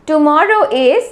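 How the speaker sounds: usual speaking voice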